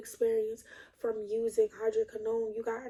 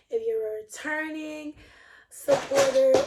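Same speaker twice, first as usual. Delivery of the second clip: pitched up and breaking toward a laugh; fumbled first take; 3 semitones higher, 4 dB louder than usual. Real, not acted. Vlogger fumbling her welcome to returning viewers, half laughing. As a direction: stumble on it, then crack up. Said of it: "oh shoot".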